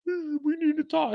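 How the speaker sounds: high, slow put-on woman's voice; high mock girlfriend voice. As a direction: mimic her serious tone, way up high. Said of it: "I, hey".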